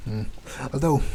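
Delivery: ordinary talk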